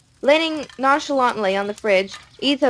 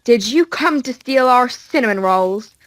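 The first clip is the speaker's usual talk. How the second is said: growly; 3 semitones higher, 5 dB louder than usual. Acted. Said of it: "tone on the verge of a growl".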